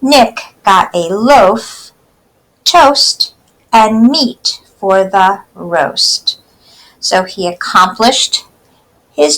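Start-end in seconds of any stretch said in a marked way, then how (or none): 0.0-6.4 put-on slow, clear poem reading for kids; slow read-aloud. Teacher reading a poem slowly to children.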